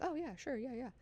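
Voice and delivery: very soft, high placating voice; small, high, doubtful agreement; mock humouring. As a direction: act as if quietly playing along with someone unhinged. Acting guard humouring Alia.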